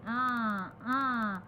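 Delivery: "un" pushed through the nose; teacher demonstrating the nasal "un"; nasal vowel. Shown as a demonstration. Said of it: "voyelles que vous prononcez avec le nez".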